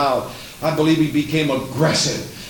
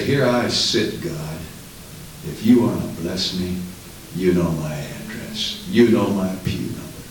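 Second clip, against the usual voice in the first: low, unmoved mock prayer; stoic passivity; 7 semitones lower than usual. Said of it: "folded arms and stoic stare".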